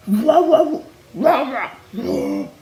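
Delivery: imitating the singer's wails. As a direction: belt the whoas like the singer, then let them die off. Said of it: "She's like".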